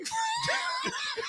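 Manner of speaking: very high squealing laugh; shrieking laugh. Real, not acted. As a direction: let out a shrill shriek of laughter.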